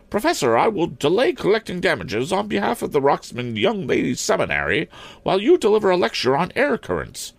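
put-on high-pitched, formal old maid principal; stern schoolmistress. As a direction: lay down terms in a high headmistress voice.

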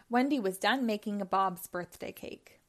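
plain reading voice

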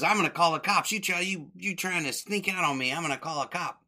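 high-pitched mock threat; indignant landlady. Landlady catching tenants sneaking out, indignant.

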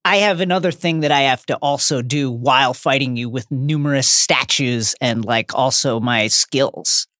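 low, slowing down smugly. Retold or imitated. Dumbledore voiced, slowing.

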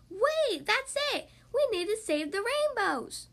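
high, bright sudden idea; excited idea voice. Acted. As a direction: burst out with the plan. Crayon has an idea.

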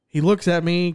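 normal talking voice

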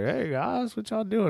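high bright mock hello from the brother-in-law; cheery high greeting. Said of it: "he's like".

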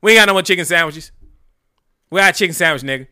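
loud, brash cashier yell. Retold or imitated. Customer imitates the KFC worker yelling at him, loud. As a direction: bark it like the annoyed cashier. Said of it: "she was like".

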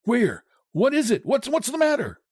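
high-pitched, startled questions; alarmed. A narrator's reading. Husband woken by his wife's cry, alarmed. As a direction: stumble over words, jolted from sleep. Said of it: "He started up in alarm".

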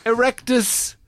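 mock spell. Mock spell after camp Dumbledore.

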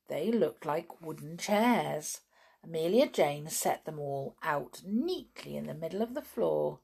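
usual speaking voice

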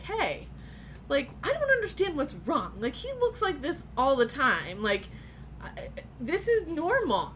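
high-pitched, puzzled pleading; insistent protest. Retold or imitated. Wife telling the nurse her husband is fine, high insistent protest. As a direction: argue it upward, as if baffled.

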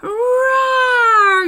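loud drawn-out roar; werewolf roar. A narrator's reading. Werewolf roaring at litterbugs, loud long roar. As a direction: let out a big monster roar. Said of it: "she roared".

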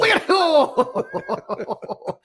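slow, high and laughing; laughing echo. Real, not acted. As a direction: repeat the joke through real laughter. Echoes the joke, laughing.

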